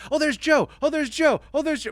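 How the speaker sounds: high, loud recognition calls; excited viewers. Retold or imitated. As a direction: mimic fans spotting him on TV. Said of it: "people would watch the show and be like".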